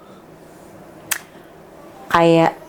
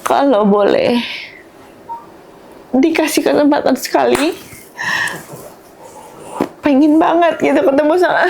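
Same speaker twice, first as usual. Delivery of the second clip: emotional longing; 6 semitones higher than usual. Real, not acted. Bereaved mother longing for her child.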